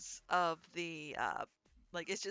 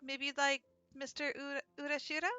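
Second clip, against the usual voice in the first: higher, louder welcome in character; a hint of a perky server greeting customers; lightly voiced eager waitress; 9 semitones higher, 5 dB louder than usual. Waitress greets her first customer, lightly played eager welcome.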